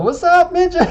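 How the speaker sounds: high, loud, laughing joke greeting; mock greeting. Joking about greeting the Costco worker, high laughing mock hello. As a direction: blurt it out through laughter. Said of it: "I don't have a need to go".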